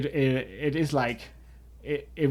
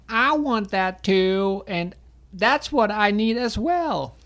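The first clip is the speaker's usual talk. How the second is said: high, slow, whining plea; whiny voice; 8 semitones higher, slower than usual. Retold or imitated. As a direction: whine it like a needy toddler. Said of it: "you like my voice, my, my whiny voice".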